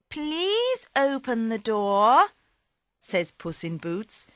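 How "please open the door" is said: higher, slower polite cat voice; cat pleading. Acted. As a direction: coax the ogre sweetly, pitched up. Puss asks ogre politely.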